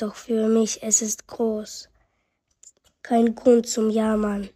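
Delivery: ordinary talk, nothing marked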